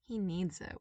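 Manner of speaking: ordinary delivery, nothing marked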